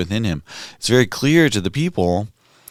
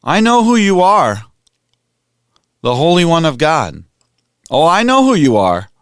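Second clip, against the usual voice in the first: loud, much higher, crying out; guttural cry; 8 semitones higher, 7 dB louder than usual. Retold or imitated. Unclean spirit cries out at Jesus in synagogue, loud cry. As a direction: yell it as a possessed man's cry. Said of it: "maybe a guttural cry".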